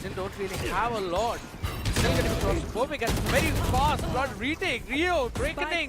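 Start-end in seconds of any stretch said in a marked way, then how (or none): 1.9-5.9 rising, excited play-by-play; esports caster. Acted. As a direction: call the retake with building hype. Live play-by-play during retake.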